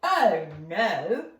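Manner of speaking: loud, booming troll voice. Acted. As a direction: boom it out like a big troll. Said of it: "boomed the troll".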